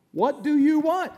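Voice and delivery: "What do you want?" high, loud and slow, hitting "you"; blunt, slow demand. Shown as a demonstration. Preacher repeating Jesus' words to the congregation, slow and emphatic. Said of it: "What did he say?".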